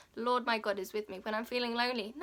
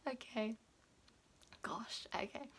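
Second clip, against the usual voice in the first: whispered; 11 dB quieter than usual. Real, not acted. Composing herself after tears.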